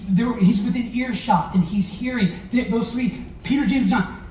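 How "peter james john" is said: ordinary talk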